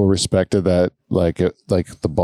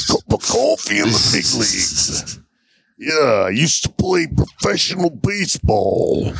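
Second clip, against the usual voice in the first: wrestler impression; 3 semitones higher than usual. Player doing a wrestler for the guessing game, wrestler impression. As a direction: stretch the words like a wrestling promo.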